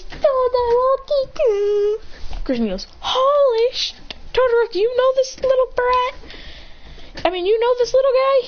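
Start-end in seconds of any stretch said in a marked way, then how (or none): 0.0-2.0 put-on high, slow childish call; whiny kid voice. Crying kid calls to friend. 3.0-8.5 high-pitched incredulous voice; shocked questioning. Acted. Shocked question in acted story.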